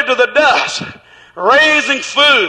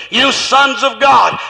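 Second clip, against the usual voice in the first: ordinary delivery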